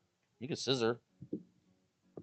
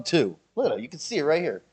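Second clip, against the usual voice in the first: ordinary talk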